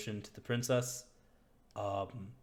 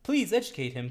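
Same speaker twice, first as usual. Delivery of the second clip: much higher, louder, tattling guard; eager guard voice; 12 semitones higher, 6 dB louder than usual. Acted. Guard reports the player's mistake to Jolliver: high, loud, eager tattling. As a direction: pipe up high and loud like a fawning underling telling on someone. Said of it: "He's like".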